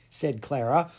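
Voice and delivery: usual speaking voice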